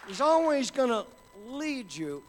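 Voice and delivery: loud, rising preacher's call; full-force preaching. Acted. Preacher driving the congregation toward God with full force. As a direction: build the sermon to a roar.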